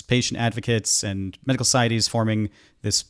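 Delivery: ordinary talk, nothing marked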